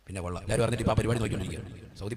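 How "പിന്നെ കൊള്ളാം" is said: ordinary talk, nothing marked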